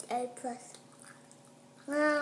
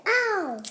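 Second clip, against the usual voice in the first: sharp ow; 6 semitones higher, 6 dB louder than usual. Real, not acted. Bites candy, cries out.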